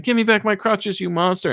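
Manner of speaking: high-pitched imitation of indignant protest; put-on distressed complaint; mock outrage. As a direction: play the wronged victim, lightly hammed up. Acting faked injured man's protest.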